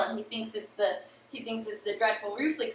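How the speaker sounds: ordinary delivery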